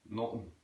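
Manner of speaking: "Nothing" as soft "notn"; Southern Irish pronunciation. Shown as a demonstration. Teacher demonstrating Southern Irish, "Nothing" without TH. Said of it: "Dropping the T H for nothing".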